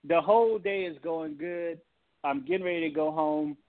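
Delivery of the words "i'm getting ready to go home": usual speaking voice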